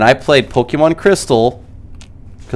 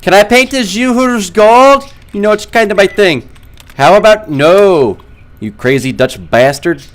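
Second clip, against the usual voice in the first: movie character voice; 9 semitones higher, 4 dB louder than usual. Retold or imitated. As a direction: ham up the movie lines, high and loud. Acted movie exchange, voices.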